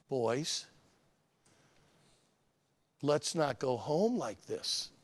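put-on soft, slow, low warning to the boys; quiet warning. Dad quietly warning his boys at Olive Garden, slow and soft. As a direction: hush it as a quiet threat. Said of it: "I say these words".

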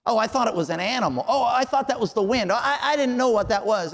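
high, loud, fast mock excuse; squeaky, indignant pretend innocence. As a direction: ham up a lame excuse, playing innocent. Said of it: "we just kinda go".

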